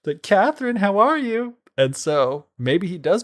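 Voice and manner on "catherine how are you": high, loud gleeful call down a trapdoor; triumphant prince calling down to a supposedly dead wife. Retold or imitated. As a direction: sing it out high like he's won. Mock calling down to corpse.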